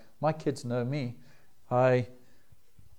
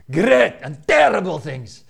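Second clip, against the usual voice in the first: slow, louder, mock-dramatic doom voice; hammed-up ominous storyteller; ominous teasing dad voice; 5 semitones higher, 12 dB louder, slower than usual. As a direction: tease the kids with a grand spooky prophecy. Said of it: "in exactly that voice".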